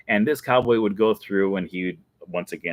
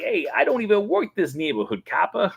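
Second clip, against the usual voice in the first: gangster; 11 semitones higher than usual. Retold or imitated. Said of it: "He'd be like".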